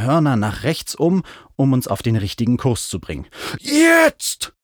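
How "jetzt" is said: high, loud, drawn-out yell; shout. A narrator's reading. Mount shouts on a mightier wingbeat, straining yell. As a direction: stretch the word into a high yell. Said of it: "schrie Mac nochmal".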